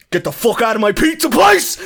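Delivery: high, loud mock-furious roar; hammed-up screaming rage; screaming boss. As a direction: bellow like an enraged boss throwing someone out. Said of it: "He's, like, screaming".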